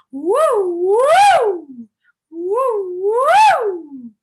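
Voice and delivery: high, loud swooping woo that rises and falls; water wheel woo. Shown as a demonstration. Teacher demonstrating the water wheel exercise, a high swooping woo. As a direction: send the oo up and over the top. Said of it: "I just want you to copy it".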